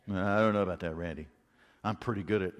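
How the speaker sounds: mock doubter. Imitating a doubting listener.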